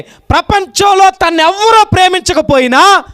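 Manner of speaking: loud, high, drawn-out sermon cry; fervent pulpit proclamation; full-force preaching. Acted. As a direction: preach it out to the crowd.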